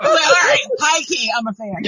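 high, loud, laughing concession; emphatic admission. Retold or imitated. Fan owns up to sister-in-law, laughingly emphatic. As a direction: blurt it out high, then settle down. Retold giving in, high key versus low.

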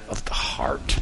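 soft whisper on the key point. Real, not acted. Pastor stressing the heart of worship to his church, whispered. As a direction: lean in and whisper it like a secret. Sermon key line, dropped voice.

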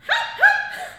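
very high, loud hi-hi call; sharp high-pitched double call; mom's call. Retold or imitated. Imitating mom calling across the bush, high call. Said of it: "I can't do my mom's voice".

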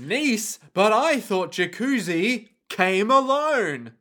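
slow, drawn-out mock astonishment; hammed-up shock; feigned shock. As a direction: act stunned, dragging out the words. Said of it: "feigning shock".

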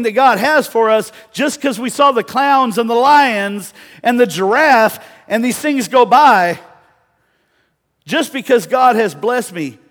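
high, very loud pulpit exhortation; impassioned shouted sermon, pitch held high; full-force preaching. Real, not acted. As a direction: thunder it out to the congregation.

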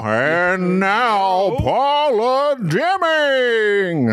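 slow, drawn-out stage announcement; grand old-time introduction; 1950s narrator. Shown as a demonstration. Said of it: "Not like SNL".

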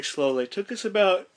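talking into a rising yawn; words stretched by a real yawn. Real, not acted. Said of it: "oh, sorry, yawning".

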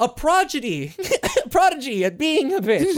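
giggling while calling her a prodigy; laughing. Real, not acted. Joking about a bratty kid.

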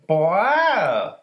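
high, loud, drawn-out Boston accent. Shown as a demonstration. Demonstrating how Boston flows, high loud Boston accent. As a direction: stretch it out in mock Boston. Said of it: "it's like".

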